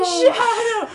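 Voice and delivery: high, loud echoed call; hammed-up tearful shout; mock movie shout. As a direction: cry the dog's name through laughter. Echoing the film's cry.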